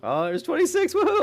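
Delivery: playful over-the-top whoop; hammed-up celebration. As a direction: overplay the joy for laughs. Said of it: "That's how much I celebrate my leveling".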